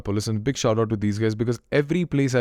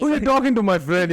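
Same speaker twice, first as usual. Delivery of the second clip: cocky challenge; 9 semitones higher than usual. Retold or imitated. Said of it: "you know, it's like".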